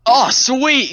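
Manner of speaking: loud, high fake delight; mock cheer. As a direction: cheer like it's great news. Mock cheer at friend's death.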